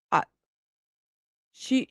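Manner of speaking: usual speaking voice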